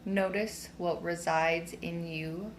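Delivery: slow, soothing meditation voice; guided meditation. Real, not acted. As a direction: guide them inward slowly, with long pauses. Leads a standing meditation.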